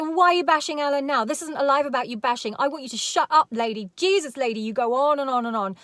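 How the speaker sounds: a hint of an irritated rant. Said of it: "Cher wouldn't let this woman continue".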